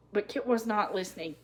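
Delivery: plain reading voice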